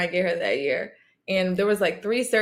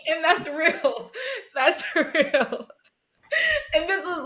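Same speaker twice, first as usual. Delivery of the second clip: high, slow, laughing through the words; real laughter, pitched up; laughing hard; 10 semitones higher, slower than usual. Real, not acted. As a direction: let the laugh take over. Laughing after joke.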